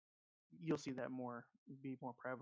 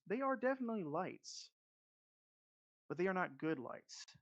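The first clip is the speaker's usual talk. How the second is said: game master scene setting; 3 dB quieter than usual. Acted. DM opening the first session for the players, ominous scene narration. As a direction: draw the players into the burning town.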